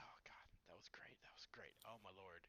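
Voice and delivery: hushed, low, whispery sigh of relief; spent satisfaction. Acted. Acted afterglow of Superman.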